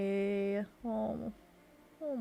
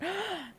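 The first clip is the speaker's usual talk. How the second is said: sharp gasp; 11 semitones higher than usual. Real, not acted. Sudden idea.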